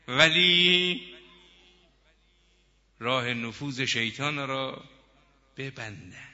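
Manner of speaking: loud, drawn-out exhortation; forceful preaching. Acted. Preacher urging listeners to block Satan's influence, exhorting loudly. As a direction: stretch key words and drive each plea home.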